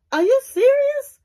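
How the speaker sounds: indignant disbelief, voice jumping up; incredulous. Retold or imitated. He reacts to her excuse.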